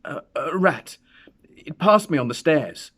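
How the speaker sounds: high, loud, voice trembling with fear; shaking tones. A narrator's reading. Old man lying to his wife about the knock, shaking. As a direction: cover the terror with a shaky lie. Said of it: "in shaking tones".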